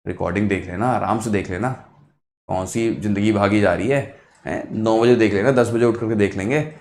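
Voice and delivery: low, soft coaxing from a lazy brain; lightly voiced lazy inner voice, quietly talking you out of it; lazy brain's voice. Brain urging the student to go back to sleep, low lazy coaxing. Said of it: "पहला thought इसका यही आएगा".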